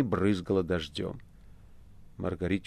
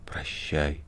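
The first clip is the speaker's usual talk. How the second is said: barely audible; 9 dB quieter, much slower than usual. A narrator's reading. The Master bids his pupil farewell, barely audibly. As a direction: let each word fade away. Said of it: "чуть слышно сказал мастер".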